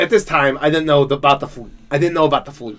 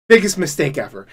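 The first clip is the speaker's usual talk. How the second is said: normal talking voice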